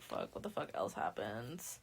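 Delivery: low, quiet aside to oneself; thinking aloud. Real, not acted. Storyteller trying to recall what happens next, muttered low. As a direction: drop right down while searching your memory.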